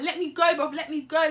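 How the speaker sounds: loud, high angry demand; struggling to get free. Retold or imitated. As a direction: shout to be let go. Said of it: "I'm like".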